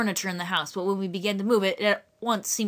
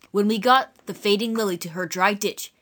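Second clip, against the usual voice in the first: plain reading voice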